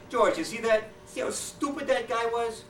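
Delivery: high, slow, gloating; smug Cheney. Acted. Cheney gloats to Bush about the taxi driver, high and slow. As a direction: savour how dumb the driver was.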